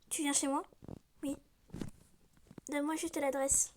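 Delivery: ordinary delivery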